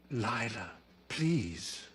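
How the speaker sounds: soft, subdued murmur; murmured plea. A narrator's reading. Tilly pleading with Lila across the table, murmuring. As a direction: plead quietly, letting each word fall. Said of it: "she murmured".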